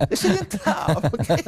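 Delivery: laughing plea. Real, not acted. Coach begs wives to let him in, laughing. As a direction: plead it through laughter.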